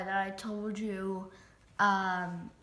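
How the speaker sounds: usual speaking voice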